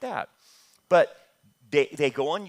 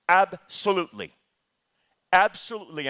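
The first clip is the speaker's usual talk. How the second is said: emphatic; 3 semitones higher, 6 dB louder than usual. Real, not acted. Emphatic preaching answer.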